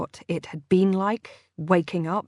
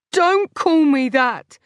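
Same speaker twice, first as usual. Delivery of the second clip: angry shout; 8 semitones higher, 6 dB louder, slower than usual. Acted. Lo shouts at Ben.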